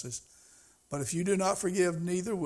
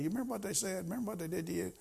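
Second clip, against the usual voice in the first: devil's taunt; 6 dB quieter, faster than usual. Retold or imitated. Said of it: "the devil would say".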